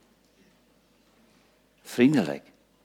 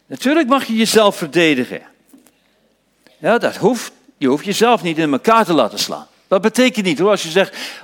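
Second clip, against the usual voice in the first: higher and louder, driving sermon voice; fiery preaching; 8 semitones higher, 5 dB louder than usual. Acted. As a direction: preach it out with fire. Preaching at full force. (vurig preken, hoger en luider)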